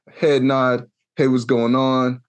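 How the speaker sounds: ordinary delivery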